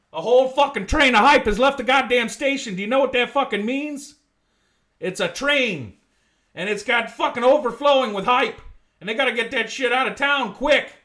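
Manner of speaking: excited streamer shouting; hyped-up rant. Real, not acted. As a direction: sell the hype like breaking news. Hyped rant about hype train.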